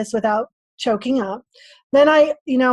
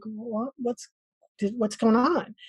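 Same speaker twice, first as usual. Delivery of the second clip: soft, hesitant retold confusion; hushed mock bewilderment; quietly bewildered; 8 dB quieter than usual. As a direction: falter softly, as if baffled. Retelling bewilderment at the crowd.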